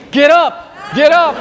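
high, loud shout at a fallen fighter; shouted retelling of yelling at a game; yelling at the screen. Retold or imitated. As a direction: yell it urgently, like willing your player up. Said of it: "So I'm yelling at the screen".

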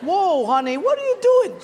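high, loud startled cry; husband stopping wife at the church door, startled; alarmed husband. Retold or imitated. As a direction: grab her back, alarmed.

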